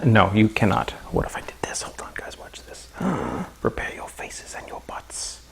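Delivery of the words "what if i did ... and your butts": hushed conspiratorial whisper with a gasp. Pretend. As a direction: whisper to the chat before the trick. Whispers before a reveal.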